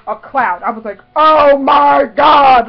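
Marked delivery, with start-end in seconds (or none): 1.2-2.7 very slow, loud awestruck yell; drawn-out ecstatic outburst; freaking out. Retold or imitated. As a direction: stretch each word in a high shout. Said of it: "Like, I was freaking out".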